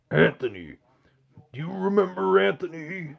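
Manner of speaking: strained wrestler impression, drawn out; Macho Man Randy Savage. Streamer voicing a chat question, wrestler impression. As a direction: put on a big, slow wrestler voice. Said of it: "answer the next question as Macho Man Randy Savage".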